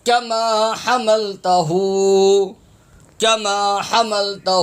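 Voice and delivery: slow recitation of کما حملتہ with a breathy throat h; correct haa. Shown as a demonstration. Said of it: "اس میں ہا کی آواز آئے گی". (کما حملتہ ٹھہر کر، ہا کے ساتھ)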